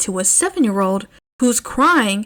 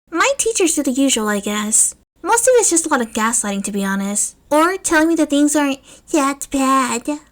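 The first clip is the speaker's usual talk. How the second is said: put-on higher, lighter boyish voice; young boy voice; 5 semitones higher than usual. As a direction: voice a matter-of-fact young kid. Narrator voices young Izuku.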